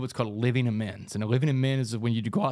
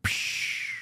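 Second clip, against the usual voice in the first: sharp hissy burst for shattering glass; imitated shattering blast. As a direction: make a "psh" of a window blowing in. Imitates window blowing out.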